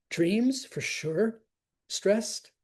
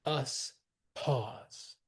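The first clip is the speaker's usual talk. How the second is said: "us ... pause" with a gap between; measured pause; 4 dB quieter than usual. Shown as a demonstration. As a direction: separate "us" and "pause", letting pause fall softer. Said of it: "wants us to pause".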